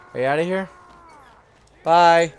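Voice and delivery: ordinary delivery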